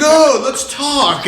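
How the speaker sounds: high, very slow, laughing oh let's talk; mocking friend. Host mimicking friend's pushy FaceTime invite. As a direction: drag it out mocking him. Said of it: "And he's like".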